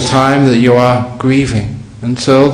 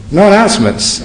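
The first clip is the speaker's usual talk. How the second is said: mock surprise; 7 semitones higher than usual. Real, not acted. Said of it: "so the announcements is".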